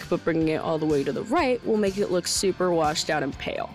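ordinary talk

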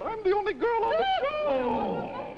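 high falsetto, slowed down, mock girly; imitating Beverly. Host mimicking Beverly's pre-show remark, high slow falsetto. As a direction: squeak it out like a coy starlet. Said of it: "she said".

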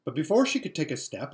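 usual speaking voice

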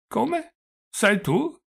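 put-on high-pitched, dazed old man; doddering old prince. A narrator's reading. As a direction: play a befuddled old prince, voice lifted high. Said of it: "con l'aria inebetita, inerte e avvizzito". (vecchio principe inebetito, voce acuta)

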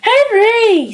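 snapped. A narrator's reading. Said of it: "snapped Mum".

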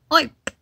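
quick squeak; startled squeak. Acted. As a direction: yelp at the tickle. Itch reaction.

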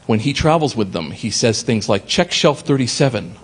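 normal talking voice

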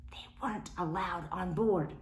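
whispered aside. A narrator's reading. Narrator's aside.

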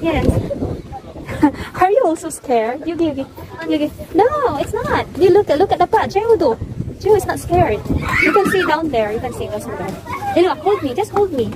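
high reassuring parent voice. Real, not acted. Mother coaxing scared kids on heights.

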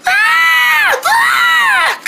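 high piercing scream of fright; terrified scream. Acted. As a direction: shriek at the spider. Spider chases them.

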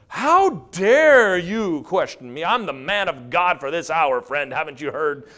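loud, drawn-out outraged man of God; mock pompous indignation. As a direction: play the offended preacher, hammed up and booming. Mock indignant, self-important pastor.

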